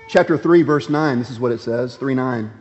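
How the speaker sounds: normal talking voice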